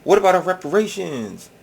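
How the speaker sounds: high, loud whiny demand; mock complaint. Mocking looters demanding their reparations, whiny. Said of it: "turn around and be like".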